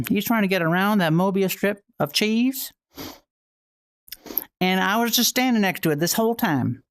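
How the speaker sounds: folksy Southern farm-host patter; Southern accent. Acted. Streamer describing a little mouse down on the farm, folksy Southern accent. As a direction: put on a playful Southern twang. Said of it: "We have a Southern accent redeem".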